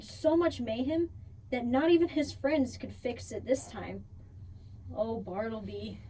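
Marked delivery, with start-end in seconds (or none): 4.9-6.0 soft, low sigh of pity; sad sigh. A narrator's reading. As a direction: sigh his name with sympathy. Sympathetic sigh over the dog.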